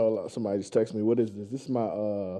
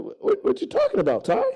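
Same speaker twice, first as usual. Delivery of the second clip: high confused squeak. Retold or imitated. Blake voiced, confused in retold story.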